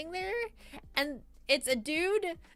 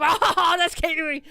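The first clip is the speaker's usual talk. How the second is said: loud, laughing cry of recognition; excited recognition; 4 semitones higher, 8 dB louder, slower than usual. Retold or imitated. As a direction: burst out on seeing your friend. Spotting friend running toward her.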